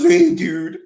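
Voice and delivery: high, slow mock surfer greeting; surfer dude. Picturing America as surfers, a high mock surfer hey dude. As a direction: play a laid-back California surfer. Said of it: "not, like, surfers".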